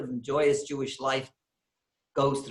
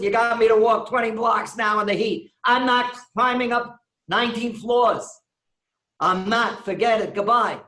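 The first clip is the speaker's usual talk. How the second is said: loud, higher exasperated outburst; fed-up refusal; 6 semitones higher, 6 dB louder than usual. Retold or imitated. Weiner refusing to climb nineteen floors, exasperated. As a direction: throw up your hands and storm off.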